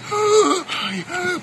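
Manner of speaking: big high gasp after being held under; sharp gasping breath; gasping for air. Retold or imitated. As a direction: gulp air like you nearly drowned. Kid let up from water.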